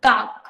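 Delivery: काक said as one clean consonant-vowel-consonant syllable; syllable demo. Shown as a demonstration. Therapist models the sample syllable काक for parents, clearly. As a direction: model काक slowly for the child. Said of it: "पहला vowel क ले लिया".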